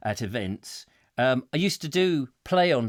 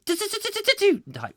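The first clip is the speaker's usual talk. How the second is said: rapid electronic zapping noise; stuttering sci-fi blaster effect; imitated ray-gun fire. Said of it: "electronic firing, uh, ray guns".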